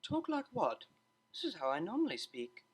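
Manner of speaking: reply a little put out, prim and proper; a touch offended, clipped by surprise; frowning reply. A narrator's reading. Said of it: "Octavia frowned as she replied".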